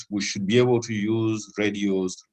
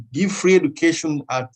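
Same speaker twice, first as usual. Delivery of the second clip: normal talking voice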